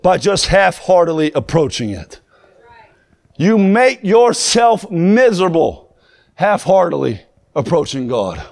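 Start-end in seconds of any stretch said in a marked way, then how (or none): 3.4-8.4 much slower, every word weighed and leaned on; slow emphatic repeat. Shown as a demonstration. Preacher repeating the point to his congregation, slow and heavy. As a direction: drag it out so it sinks in.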